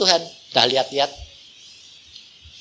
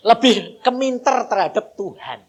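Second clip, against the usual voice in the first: normal talking voice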